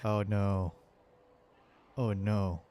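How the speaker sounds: usual speaking voice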